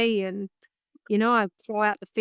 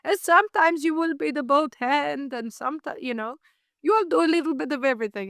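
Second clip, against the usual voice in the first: put-on old boss voice; 5 semitones higher than usual. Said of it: "It's like".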